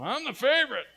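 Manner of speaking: loud, high, smug bragging; smug boast. Retold or imitated. Favorite child bragging on Facebook, smug and loud. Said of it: "I see you sometimes on Facebook going".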